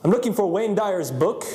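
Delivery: hint of a put-on phone voice; weird voice. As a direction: fake a stranger asking for the book. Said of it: "he would talk in a weird voice".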